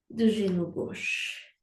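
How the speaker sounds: ordinary talk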